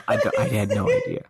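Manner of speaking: giggling through the words; laughing at yourself. Real, not acted. Embarrassed laughter at mutual correction.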